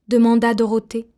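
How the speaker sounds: plain reading voice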